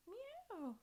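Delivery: high little meow; a quick high cat meow. Shown as a demonstration. Yoga teacher meowing like a cat for the kids.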